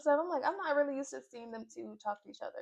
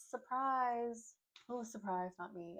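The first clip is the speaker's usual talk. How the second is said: fading to a dry, quiet sarcastic mutter; deadpan, not buying the surprise at all; dry sarcasm; 7 dB quieter, slower than usual. Real, not acted. Talking to viewers, sarcastic that nobody should be surprised.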